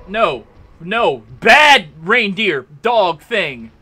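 loud, slow telling-off, as to a dog; drawn-out, playful reprimand; mock scolding. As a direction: scold it like a naughty pet, word by word.

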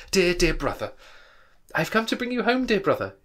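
put-on high, loud excited little girl. A narrator's reading. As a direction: pipe up as an affectionate young sister. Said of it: "a little girl, much younger than the boy".

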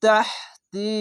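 sung Arabic recitation of তাহতি with a clear throat h; Arabic word তাহতি intoned like a Quran reciter. Shown as a demonstration. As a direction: recite তাহতি slowly in tajweed style. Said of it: "এখানে একটি বিষয় মনে রাখবেন".

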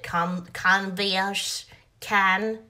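put-on canvas stretched out slow, like a toddler; child mangling a word. Imitating a toddler copying a parent's word. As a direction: sound out canvas slowly like a little kid. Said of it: "if the child just repeats it".

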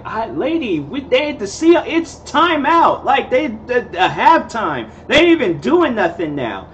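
high, pleading protest; flustered, trying to explain to her; exasperated. Retold or imitated. Exasperated reply to drunk lady.

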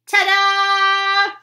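triumphant yell. Acted. Annie yelling at the guards as she reveals the flashlight. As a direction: announce it like a magician's reveal. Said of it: "she yelled".

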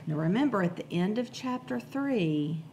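ordinary delivery, nothing marked

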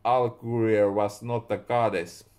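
plain reading voice

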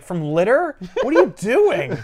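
mock bafflement. Man answering her claim the day is saved, mock baffled. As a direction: push the question up in exasperated disbelief. Acting out his baffled retort.

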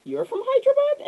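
very high, astonished question; amazed surprise. Retold or imitated. Author learning the men are from Hyderabad, astonished. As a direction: gasp it out in disbelief. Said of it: "And we were like".

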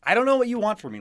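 loud, high frustration; exasperated plea. Acted. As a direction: argue it out, pitch and volume up. Frustrated quarrel with partner.

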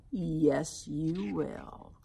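quiet menacing growl; low monster growl. Acted. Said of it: "he growled".

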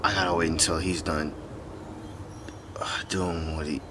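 low, whispery confiding; soft, stressed-out muttering; anxious hush. Acted. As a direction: murmur nervously, keeping out of earshot. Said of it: "my anxiety, like, is, like, up here".

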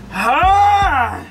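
loud high battle cry; hyped yell. Real, not acted. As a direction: let out a big pumped-up yell. Before eating spicy noodles.

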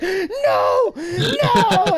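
very high, loud panicked no; panicked shout. Retold or imitated. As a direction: scream it like the ramps are closing in. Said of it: "I'm like".

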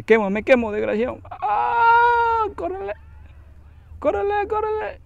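high, loud cries with a shriek; panicked screaming. Real, not acted. Gamer screaming while his character burns and runs. Character burning, running away.

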